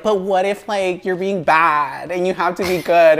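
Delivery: mock a nagging skeptic; whiny devil's advocate. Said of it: "devil's advocates that are like".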